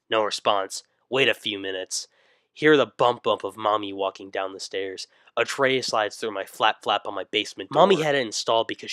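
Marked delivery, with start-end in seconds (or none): none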